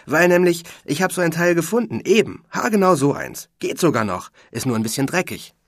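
high, loud and fast, words tumbling out; can't wait to share it; excited. A narrator's reading. Rocky tells Niklas his news on the phone, excited and fast. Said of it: "sagt Rockys Stimme aufgeregt".

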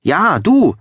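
high, loud cheer of agreement; calling out. A narrator's reading. Another person in the hall backing the nomination, calling out. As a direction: call it out, pitch leaping up. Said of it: "rief ein anderer".

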